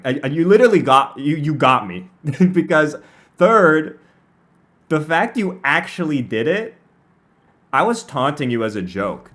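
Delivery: ordinary talk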